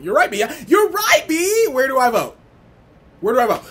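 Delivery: high, loud cheering squeal; wild unhinged ranting. Real, not acted. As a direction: shriek it like a fan. Ecstatic cheering.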